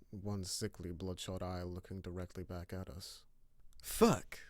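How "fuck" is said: high, loud startled curse; sharp curse. Acted. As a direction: blurt it out, alarmed. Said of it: "muttered Murph".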